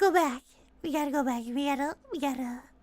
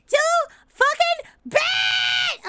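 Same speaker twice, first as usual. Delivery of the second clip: drawn-out, higher-pitched playful yell; mock defiant shout; 5 semitones higher, 4 dB louder, slower than usual. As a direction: stretch it out slowly and loudly, gloating. Louder drawn-out repeat after laughing.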